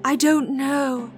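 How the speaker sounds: slow, distant and dreamy; as if half asleep; faraway dreamy voice; dreamlike. A narrator's reading. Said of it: "in a dreamlike voice".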